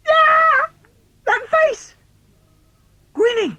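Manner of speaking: very high loud shriek of fright; boy shrieks at a face on the wall; terrified shriek. Acted. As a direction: scream at the grinning face. Child sees face in dark.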